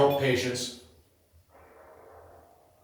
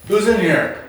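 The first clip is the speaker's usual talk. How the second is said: raised, higher call into the empty room; 6 semitones higher, 5 dB louder than usual. Real, not acted. Ghost hunter calling out to spirits in the room, raised and high. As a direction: call out loud to whoever's there.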